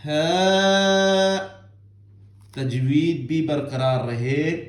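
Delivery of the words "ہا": ہا held long, higher and louder; recited letter. Shown as a demonstration. Teacher practising ہا with the class, drawn out. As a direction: recite ہا cleanly without forcing. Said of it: "اس کی practice ہم کریں گے".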